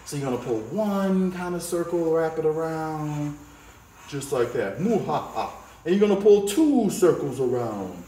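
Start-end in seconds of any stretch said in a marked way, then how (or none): 4.8-5.6 soft mock evil laugh; villain chuckle, played for fun. Teacher playing villain over the drawing, mock cackle.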